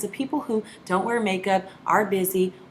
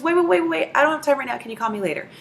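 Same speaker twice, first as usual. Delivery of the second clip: hurried brush-off; 7 semitones higher, 3 dB louder, faster than usual. Retold or imitated. Busy friend cutting her off.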